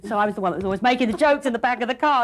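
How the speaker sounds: usual speaking voice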